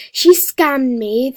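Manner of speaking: loud, slow, indignant; yelling she scammed me; angry complaint. Real, not acted. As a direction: call her out loudly.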